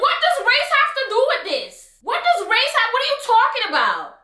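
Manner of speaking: can't believe what she's hearing; mock-outraged what-are-you-talking-about; incredulous. Retold outrage at friend.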